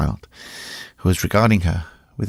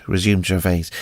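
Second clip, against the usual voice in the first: ordinary delivery, nothing marked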